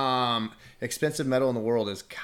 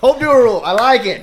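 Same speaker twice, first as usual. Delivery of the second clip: excited; 13 semitones higher, 18 dB louder than usual. Real, not acted. Excited echo of new name.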